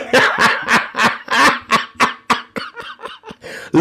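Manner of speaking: long high squealing laugh; high real laughter. Real, not acted. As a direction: keep laughing helplessly. Still cracking up.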